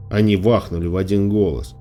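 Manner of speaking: ordinary delivery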